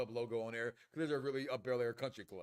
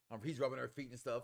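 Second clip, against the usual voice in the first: ordinary delivery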